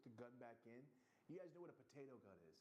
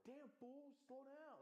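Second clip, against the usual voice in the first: high-pitched mock scolding; high exasperated protest; imitating annoyed driver; slower than usual. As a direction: mimic a complaint at a reckless driver, way up high. Acting out annoyed drivers' reaction.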